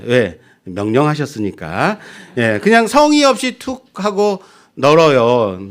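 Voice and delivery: ordinary talk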